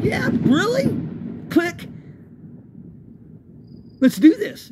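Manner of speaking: menacing dare. Retold or imitated. Narrator pulling his own knife, menacing dare. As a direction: call his bluff with your blade out.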